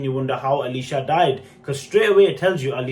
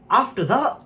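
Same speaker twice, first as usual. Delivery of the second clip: usual speaking voice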